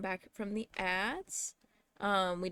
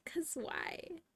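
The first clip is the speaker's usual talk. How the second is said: whispered; 7 semitones higher, 10 dB quieter than usual. Shown as a demonstration. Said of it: "Can we just talk about that".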